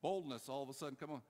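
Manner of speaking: ordinary talk, nothing marked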